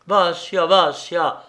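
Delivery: loud, high cry of the name; Vassia, Vassia! shouted out high; desperate cry. A narrator's reading. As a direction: call him back in despair. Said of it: "s'écria encore une fois".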